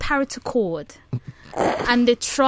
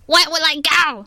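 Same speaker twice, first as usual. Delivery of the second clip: a hint of parrot squawk; lightly voiced parrot woman; 5 semitones higher than usual. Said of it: "do it in the voice of a parrot".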